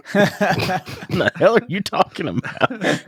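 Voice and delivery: high, slow, baffled disbelief; incredulous. Retold or imitated. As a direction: stretch out the disbelief.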